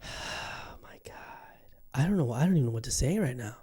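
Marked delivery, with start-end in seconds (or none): 0.0-1.1 a much softer, slow, sighing whisper; stunned whisper. Real, not acted. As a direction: let it out on a breath, defeated. Stunned by ranking.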